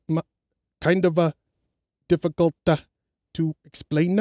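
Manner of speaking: imitating a Welsh woman's accent, with lots of hesitation; Welsh accent impression. Imitating the Welsh woman from the UK show in a Welsh accent. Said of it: "I'm gonna try to impersonate that, that accent".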